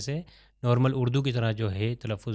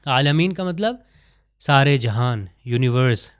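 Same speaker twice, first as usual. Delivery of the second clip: ordinary delivery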